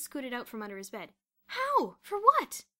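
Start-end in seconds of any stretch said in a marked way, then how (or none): 1.5-2.7 high, loud disbelief; astonished questions. A narrator's reading. Boots hearing of the money, astonished outburst. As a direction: fire out startled questions. Astonished boy asks about prize.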